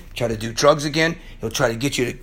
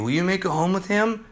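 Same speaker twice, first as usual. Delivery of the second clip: ordinary talk, nothing marked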